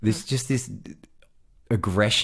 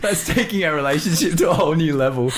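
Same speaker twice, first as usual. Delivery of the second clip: higher, giggly voice; real laughing; 8 semitones higher than usual. Real, not acted. Boyfriend joking about their relationship, still laughing. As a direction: comment while still chuckling.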